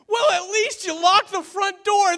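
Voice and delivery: very high, slow incredulous plea; hammed-up high-pitched alarm; mock desperate disbelief. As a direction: imitate a friend at her wits' end. Acted friend in escalating disbelief.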